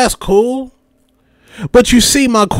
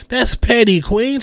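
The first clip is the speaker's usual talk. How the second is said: ordinary talk, nothing marked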